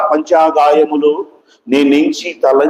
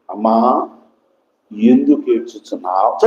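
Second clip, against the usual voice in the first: gentle questioning; 6 semitones lower than usual. Retold or imitated. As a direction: ask it gently, pitched well down. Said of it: "వచ్చిన ఆయన అంటున్నాడు". (మెల్లగా, తగ్గు స్వరంతో అడగడం)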